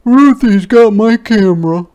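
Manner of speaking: put-on high, loud upset boy; crying out. Martin cries out to the teacher, high and loud. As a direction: wail it to the teacher. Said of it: "cried Martin".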